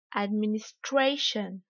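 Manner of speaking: "administration" slow in English, STRAY drawn out; slow teacher's "administration", peak on STRAY; exaggerated English stress. Shown as a demonstration. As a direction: lean hard on STRAY in "administration", light "shun". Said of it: "je vais délibérément exagérer".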